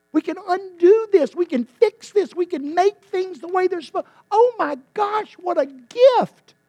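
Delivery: high, loud, building excitement; rising joy. Acted. Doctor's good news, then joy.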